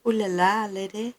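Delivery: high, slow French madame voice; French accent. Pretend. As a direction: purr it like a French lady. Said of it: "she's got a French accent".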